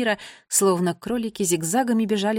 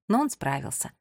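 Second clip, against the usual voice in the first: ordinary delivery, nothing marked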